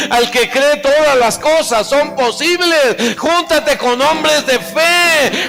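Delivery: building to loud, high, ringing exhortation; full-force preaching. Real, not acted. Preacher urging the congregation to keep company with people of faith, full-force preaching.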